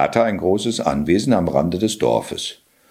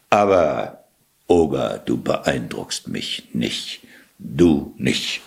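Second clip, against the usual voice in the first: low defiant whisper; 8 semitones lower, slower than usual. Acted. Cat defies ogre, dropping voice.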